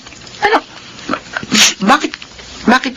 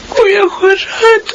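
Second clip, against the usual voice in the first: crying; 9 semitones higher, much slower than usual. Acted. Mother cries to brother.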